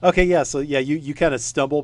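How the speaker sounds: ordinary talk, nothing marked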